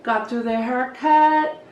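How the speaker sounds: ordinary talk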